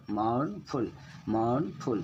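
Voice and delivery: mournful slowly, "mourn" held long, "ful" short; mournful split into two beats, weight on the first; stressed first syllable. Shown as a demonstration. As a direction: linger on "mourn" in each mournful. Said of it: "थोड़ा ज़्यादा time दे के पढ़ रहे हैं".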